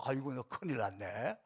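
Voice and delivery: ordinary talk, nothing marked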